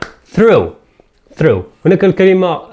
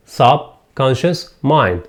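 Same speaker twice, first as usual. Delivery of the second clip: "Subconscious mind" very slowly, a gap after each part; broken into parts; much slower than usual. Shown as a demonstration. Teacher dividing the word for learners, broken into parts. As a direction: separate "Subconscious mind" into sub, conscious, mind. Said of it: "نقسم هذه الكلمة".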